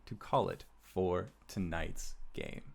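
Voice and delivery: normal talking voice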